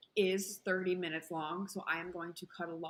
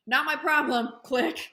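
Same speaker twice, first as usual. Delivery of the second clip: loud, high mock snap; defiant retort; 7 semitones higher, 10 dB louder than usual. Refusing the creepy guy on the phone, a loud defiant retort. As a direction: fling it back at him and hang up. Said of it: "And I'm like".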